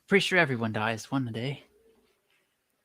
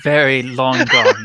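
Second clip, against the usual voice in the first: loud; 12 dB louder, slower than usual. Acted. Player answers owl.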